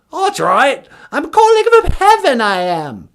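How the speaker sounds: put-on very high, loud posh British old lady; squeaky mock Queen Mum; Queen of England. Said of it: "Queen Elizabeth?".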